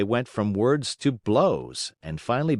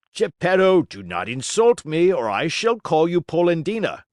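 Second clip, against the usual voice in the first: higher, louder threat; loud warning; 7 semitones higher, 5 dB louder than usual. A narrator's reading. Carpenter threatens Geppetto, louder and higher. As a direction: warn him hard.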